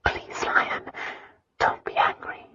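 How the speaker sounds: soft, pleading whisper; frightened whisper. A narrator's reading. Christina begs Lion not to be angry, whispering. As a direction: whisper it as a plea. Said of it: "Christina whispered".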